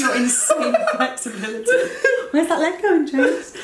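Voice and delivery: teasing through real laughter; laughing tease. Real, not acted. Host teasing partner about his flexibility, laughing. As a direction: giggle through the words, pitch lifting.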